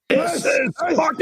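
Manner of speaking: high, drawn-out full shout; mock battle cry. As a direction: bellow it like a warrior king, slowly.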